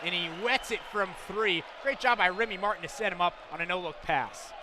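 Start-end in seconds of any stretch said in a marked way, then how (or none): none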